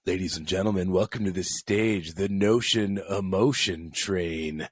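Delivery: low announcer voice, slowing on the name; strip-club announcer. Pretend. Introducing a male stripper to the crowd, mock low announcer.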